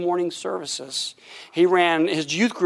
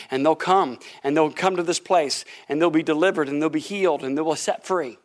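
lower, solemn prophetic declaration; prophecy; 5 semitones lower than usual. Retold or imitated. Preacher retells his prophecy to the church, solemn proclaiming. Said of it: "I kinda remember the prophecy".

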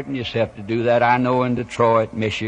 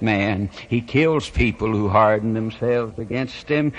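usual speaking voice

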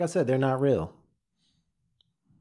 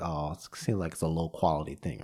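lower, softer unimpressed inner voice; doubtful listener; 9 semitones lower, 8 dB quieter than usual. Retold or imitated. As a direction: sound like you already wrote it off as cheap. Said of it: "you're like".

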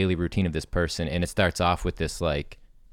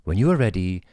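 mock meditation app. Shown as a demonstration. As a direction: lead a morning self-care meditation gently. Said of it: "your voice is perfect for it".

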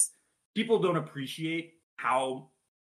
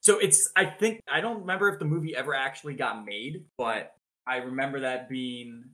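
ordinary talk, nothing marked

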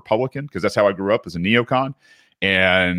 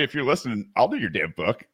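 ordinary delivery

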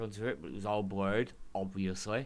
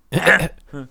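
loud, high barked noise; botched wrestler impression, one loud burst; Scott Steiner; 6 semitones higher, 15 dB louder than usual. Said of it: "that was my Scott Steiner impression".

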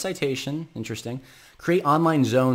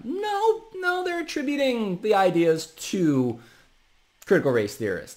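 mocking voice; 11 semitones higher than usual. Mocking the critics' logic.

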